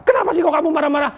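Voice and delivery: quick, high-pitched challenge; acted questioner. Questioning an angry person why, quick and high. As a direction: ask it fast and high, as if confronting someone. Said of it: "ketika dia marah-marah".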